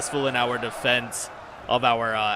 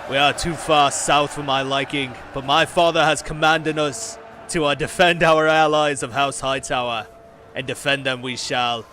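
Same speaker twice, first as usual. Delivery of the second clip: solemn northern commander, voice raised; Jon Snow impersonation; 3 semitones higher, 4 dB louder than usual. Acted. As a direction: address the lords loudly in a northern accent. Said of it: "my best Jon Snow impersonation".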